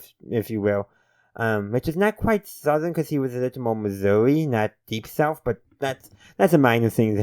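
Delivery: normal talking voice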